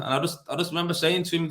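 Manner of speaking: ordinary talk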